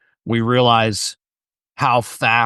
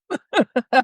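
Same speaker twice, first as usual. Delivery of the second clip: high laugh. Real, not acted. Laughing at smoking memory.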